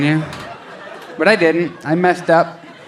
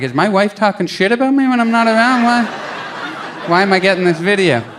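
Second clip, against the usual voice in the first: mock paranoid; 6 semitones higher than usual. Comic retelling his suspicious thought.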